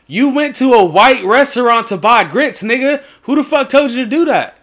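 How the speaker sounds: high, loud disbelieving shout; incredulous yell. Real, not acted. Incredulous yelling at friend.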